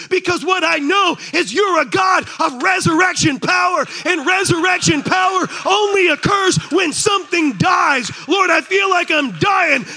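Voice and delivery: high, loud impassioned preaching; preaching at full force. Acted. Pastor proclaiming faith through hardship to congregation, full-force preaching. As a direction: pour it out, shouting to the rafters.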